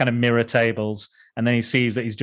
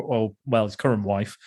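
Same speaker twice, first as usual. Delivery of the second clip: usual speaking voice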